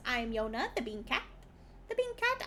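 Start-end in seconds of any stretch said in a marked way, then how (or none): 1.9-2.4 high, sweet mascot voice; cute anime streamer. Acted. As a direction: chirp your own nickname. Cute self-introduction.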